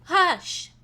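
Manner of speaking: sharp loud shush; hush. Retold or imitated. Mimicking the noisy women at the spa, sharp hush. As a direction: hush them loudly.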